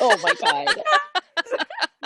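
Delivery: high, slow, laughing disbelief; stunned laughing. Real, not acted. Player reeling from the twist, high laughing disbelief. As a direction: gasp it out through a laugh.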